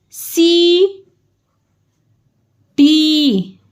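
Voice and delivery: usual speaking voice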